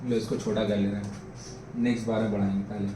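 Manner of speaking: low, soft mutter to oneself; muttered aside. Real, not acted. Teacher fixing the drawing, talking to himself, muttered aside. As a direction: drop the voice right down, as if thinking aloud.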